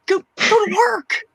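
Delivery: high, disbelieving push to get a job; exasperated order. Retold or imitated. Telling the jobless brother to work, high and exasperated. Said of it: "And I'm like".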